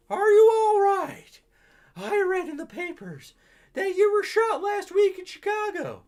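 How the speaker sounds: put-on high, loud old lady; granny voice. Granny greets the detective in a high, loud old-lady voice.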